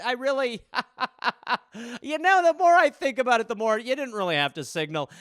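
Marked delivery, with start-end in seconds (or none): 0.0-3.5 breaking into laughter; laughing. Real, not acted. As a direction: laugh through the words.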